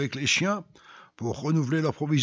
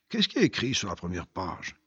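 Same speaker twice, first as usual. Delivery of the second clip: usual speaking voice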